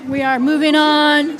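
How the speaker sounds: loud, firm call over a rowdy room; firm moving on. Real, not acted. Board chair over rowdy staff, loud and firm. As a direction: push the meeting forward loudly. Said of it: "They're, they're rowdy".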